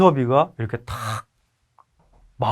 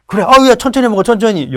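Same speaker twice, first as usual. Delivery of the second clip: fond father calling out; 8 semitones higher, 7 dB louder, faster than usual. Retold or imitated. Father telling son slow down.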